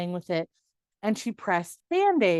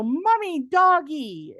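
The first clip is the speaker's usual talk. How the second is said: put-on higher, louder little kid naming a dog; a light toddler voice calling for mommy; small child; 8 semitones higher, 5 dB louder, slower than usual. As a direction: chirp it like a toddler pointing. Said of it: "they go".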